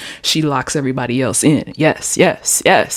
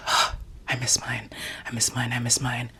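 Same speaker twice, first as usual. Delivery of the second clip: hushed, low retold longing; gasp, then softly whisper the longing; wistful mock whisper; 4 semitones lower, 10 dB quieter than usual.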